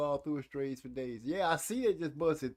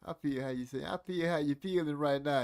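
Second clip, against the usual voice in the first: ordinary talk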